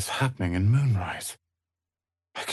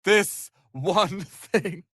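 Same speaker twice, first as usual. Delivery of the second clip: laughing; 7 semitones higher, slower than usual. Acted. Rueful laugh mid line.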